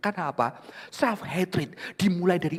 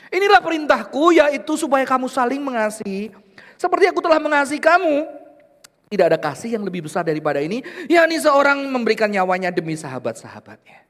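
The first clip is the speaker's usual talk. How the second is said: scripture reading; 11 semitones higher, 11 dB louder than usual. Acted. Reads scripture aloud.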